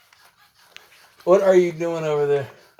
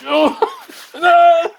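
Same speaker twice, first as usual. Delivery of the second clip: sudden high loud no; startled cry; 9 semitones higher, 8 dB louder than usual. Real, not acted. Owner yelping as his playful dog jumps up. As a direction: yelp as the dog lunges.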